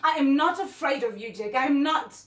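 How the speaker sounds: loud, high, building to a shout; forceful shouted defiance; angry defiance. Acted. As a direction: stand up to him at full volume. Acted defiance toward absent husband.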